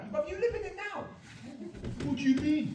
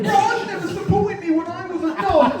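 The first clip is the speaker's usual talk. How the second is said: mock reality-show whine; 13 semitones higher, 5 dB louder than usual. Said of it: "Channel Five show on an island".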